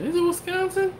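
high falsetto mock question; comically high-pitched wondering; incredulous question. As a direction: squeak it, voice leaping way up. Debating a guess with himself.

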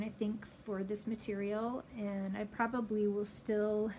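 muffled behind fabric mask; speaking through cloth covering; through a face mask. Real, not acted. Seen 2: Pink fabric mask covers mouth and nose throughout labelled words.

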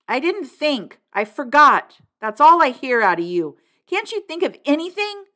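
loud, high mocking rant; furious brother shouting down the kid; angry yell. A narrator's reading. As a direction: hammer each excuse. Brother keeps yelling at kid.

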